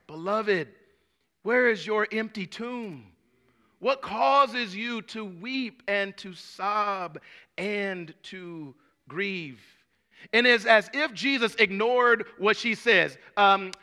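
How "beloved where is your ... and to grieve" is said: slow and soft pastoral question; tender appeal. Real, not acted. Preacher asking the congregation softly about their grief. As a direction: draw them in gently.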